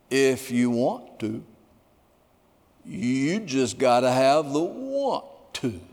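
slow, drawn-out, lightly voiced imitation; Alan Oggs' speech. Preacher voicing Alan's answer to admirers, slow and drawn out. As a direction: say it slowly, word by word. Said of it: "He said it like this".

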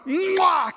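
big smacking "mwah"; exaggerated kiss sound. Preacher kissing the player's foot, hammed-up smooch.

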